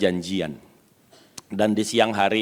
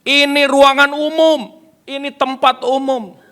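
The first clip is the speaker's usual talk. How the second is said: high, raised voice in indignant protest; imitated yelling; 13 semitones higher, 6 dB louder than usual. Preacher voices the guest objecting to church music, high indignant shouting. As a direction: shout the complaint across the room, starting slow and loud. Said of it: "dia teriak, dia berdiri".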